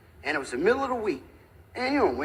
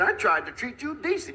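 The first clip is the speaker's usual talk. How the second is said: higher-pitched, aggrieved little fellow; mock indignation; 6 semitones higher than usual. Little fellow answering the big guy's threat, indignant. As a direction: protest as the wronged party.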